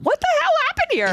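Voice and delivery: very high incredulous squeal; mock bewilderment. Host reacting to a two-year-old's video, squeaky mock bewilderment. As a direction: shoot up high in baffled disbelief.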